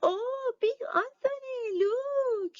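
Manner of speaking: high, fast, alarmed nun voice; a startled sister crying out high; a nun gasps high and quick to Big Anthony. A narrator's reading. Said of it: "a sister from the convent said".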